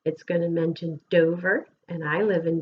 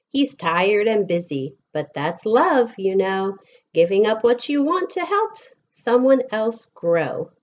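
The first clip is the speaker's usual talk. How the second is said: lilting, sing-song picture book reading; gentle storytime voice with bouncing rhythm; reading to children; 4 semitones higher, 5 dB louder than usual. Acted. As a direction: read the rhymes aloud to a class of kids. Reads picture book to class.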